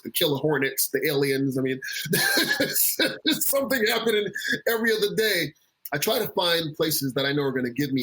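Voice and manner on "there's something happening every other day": talking through a laugh, higher pitched. Real, not acted. Listing one disaster after another, laughing. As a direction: laugh helplessly while saying it. Laughs at pile-up of bad news.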